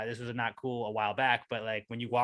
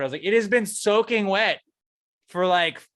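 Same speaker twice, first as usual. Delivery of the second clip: usual speaking voice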